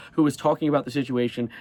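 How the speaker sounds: ordinary talk, nothing marked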